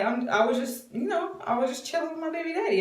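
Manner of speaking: high, quick, coy re-enactment; mock-innocent and teasing; silly hinting. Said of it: "just doing it like that".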